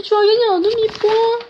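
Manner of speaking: put-on high baby-talk voice. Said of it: "arrête de faire la voix de bébé".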